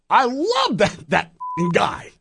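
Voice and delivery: high-pitched, wound-up gush; imitating a man bursting with enthusiasm; Regis impression. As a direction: jump up into an exuberant showman voice. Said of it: "he just got wound up".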